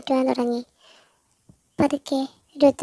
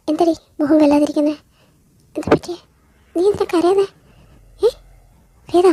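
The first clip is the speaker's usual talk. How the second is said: alarmed concern; 4 semitones higher, 6 dB louder than usual. Acted. Stella alarmed at Veda crying.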